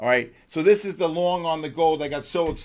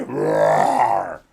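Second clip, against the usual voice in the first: deep growling bear roar; hammed-up bear roar; 8 semitones lower, 6 dB louder than usual. Shown as a demonstration. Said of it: "The bear sound".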